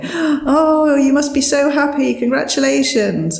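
hammed-up cheerful villagers; excited villagers. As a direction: gush like delighted neighbours. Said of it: "they say".

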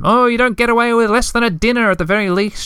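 high, fluttery and anxious; fret, as if late already; nervous. A narrator's reading. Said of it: "she said nervously".

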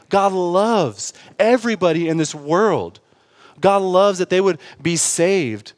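impassioned pulpit preaching; full-force preaching. Acted. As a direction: preach it out to the room.

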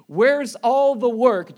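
slow, loud, disbelieving preacher's question; incredulous shout. Real, not acted. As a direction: call it out like you can't believe it.

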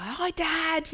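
a hint of a cheeky sedated boy; bold boy. Imitating the groggy boy greeting his dad, lightly cheeky. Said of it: "just being really, like, bold".

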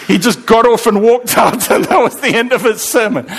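chuckling while telling; laughing. Real, not acted. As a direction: laugh through the words. Laughing at a funny story.

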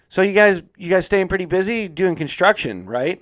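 lightly voiced chatty receptionist, high and loud; a hint of a bubbly receptionist making small talk. Receptionist chatting up a patient, a light bubbly mock voice. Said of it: "she goes".

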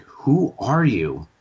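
slow, searching question; slow, puzzled question. Retold or imitated. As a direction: ask it slowly, as if puzzled. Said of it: "they're like".

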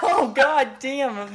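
slow, high laughing exclamation; laughing curse. Real, not acted. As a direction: stretch it out while laughing. Laughing at failed round.

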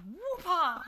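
high loud whip-crack call. Retold or imitated. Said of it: "It's the whip sound".

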